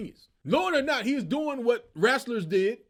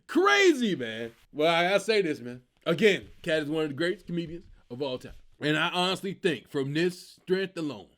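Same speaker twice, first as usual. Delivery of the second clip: ordinary delivery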